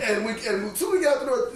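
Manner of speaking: plain reading voice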